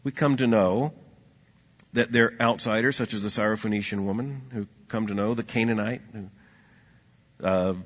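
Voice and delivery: ordinary talk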